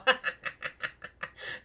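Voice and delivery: breathy unseen giggle; cat sniggers at the rat; mocking cat laugh. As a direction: snicker from the shadows. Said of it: "the voice go".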